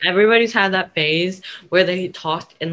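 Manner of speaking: normal talking voice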